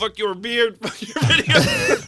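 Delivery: loud high laughing yell; mock furious rant. Voicing the beard-hating commenter, loud laughing outburst. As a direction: shout it as the angry commenter through laughter. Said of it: "mock commenter rant".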